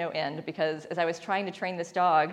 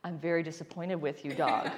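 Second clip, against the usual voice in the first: lower, softer, mock stern scold; mock disappointed owner; 6 semitones lower, 6 dB quieter than usual. Owner telling the dog she is disappointed, mock stern. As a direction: scold the dog in a lightly hammed-up low voice. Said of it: "you try to do the".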